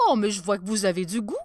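fast flattering praise; smug tailor. Acted. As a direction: rattle off the compliment. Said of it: "dit l'un des tailleurs".

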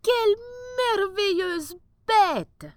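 a king amazed by a wondrous beast; high, slow royal exclamation of wonder; sultan's exclamation. Acted. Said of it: "s'écria le sultan".

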